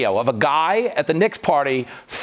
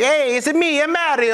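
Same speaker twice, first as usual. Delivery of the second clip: high mock Italian accent, Mario style; 8 semitones higher than usual. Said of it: "that's an Italian accent".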